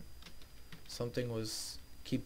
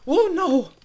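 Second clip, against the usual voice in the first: very high, loud shriek; real alarmed cry; panicked oh no; 15 dB louder than usual. Real, not acted. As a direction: shriek as it all goes wrong. Game disaster.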